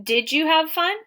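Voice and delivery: "Did you have fun" high, rising to the end; asking. Shown as a demonstration. Teacher showing what asking sounds like, questioning. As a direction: ask it as a question, lifting "fun" upward. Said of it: "They're not asking".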